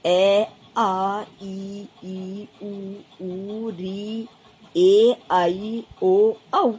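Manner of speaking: vowels read one at a time, some drawn out; reciting vowels. Shown as a demonstration. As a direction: read the vowel row slowly for a class. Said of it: "जैसे यहाँ मैंने लिखे हैं". (स्वर एक-एक करके धीरे बोलना)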